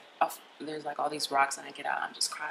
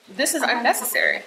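retold firm protest, higher-pitched; raised, insistent objection; indignant objection; 6 semitones higher, 4 dB louder than usual. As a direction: reenact objecting to an officer. Retold objection to drawn gun.

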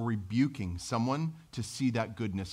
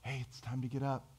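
soft sweet wake-up call, much quieter; very sweetly; 9 dB quieter than usual. Retold or imitated. Parent waking a child, softly sweet. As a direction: coax the child awake gently. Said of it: "very sweetly say".

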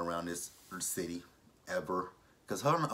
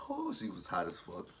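ordinary delivery

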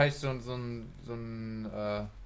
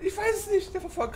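panicked; 4 dB louder than usual. Real, not acted. Panicked during chase.

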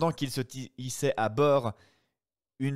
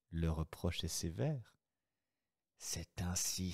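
ordinary talk

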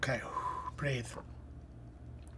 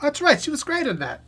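excited recognition; 10 semitones higher, 4 dB louder than usual. Real, not acted. Suddenly recalls the actress.

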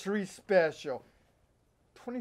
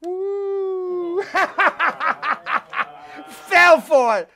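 high whoop, then laughing, louder and slow; gloating laugh; 9 semitones higher, 5 dB louder, much slower than usual. Acted. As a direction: crow over the trick. Host tricked the guest.

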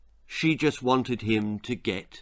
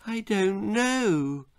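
put-on bewildered boy; 8 semitones higher, slower than usual. A narrator's reading. Bill answering his mother about the sprouts, bewildered. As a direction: voice a small puzzled boy, pitched up. Bewildered boy's voice in narrated story.

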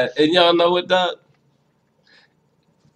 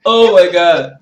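crying laughter; 8 semitones higher, slower than usual. Real, not acted.

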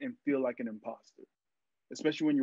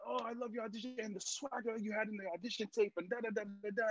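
put-on higher and softer, a failing Mexican accent; botched Mexican accent; 8 semitones higher, 7 dB quieter than usual. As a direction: rattle off his compliments in a half-hearted Mexican accent. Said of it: "I'm gonna butcher his accent".

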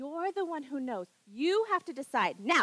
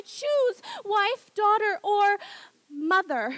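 pushing louder and higher on choose; loud demand; 4 semitones higher, 8 dB louder, much slower than usual. Acted. Pressing her husband to decide, loud and insistent. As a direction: force him to pick, voice raised.

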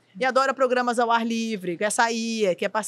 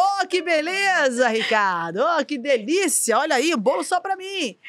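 high, drawn-out mock delight; put-on delight; 3 semitones higher, slower than usual. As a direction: gush slowly over a gift, hammed-up. Acted on-air delight at cake.

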